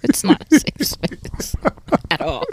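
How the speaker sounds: whispered through a laugh. Real, not acted.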